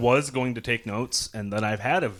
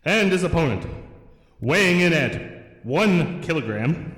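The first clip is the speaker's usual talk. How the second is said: grand entrance announcement; 5 semitones higher than usual. Pretend. Ring announcer introduces opponent.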